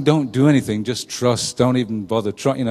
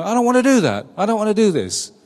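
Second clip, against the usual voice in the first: sulky rebellious son; 7 semitones higher, faster than usual. Retold or imitated. Rebellious son voiced in parable.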